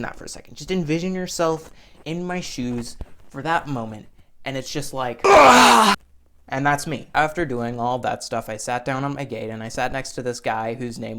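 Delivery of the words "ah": sharp loud high yell of despair; a short cry of airport misery; loud anguished Ah; frustrated scream. Retold or imitated. Imagining the airport moment.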